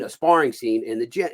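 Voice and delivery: ordinary delivery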